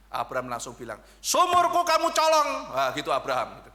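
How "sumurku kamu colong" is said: high, loud angry outburst; shouting at the thief who took his well; furious accusation. Retold or imitated. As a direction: snap it out like a man robbed. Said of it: "Ngamuk langsung gitu".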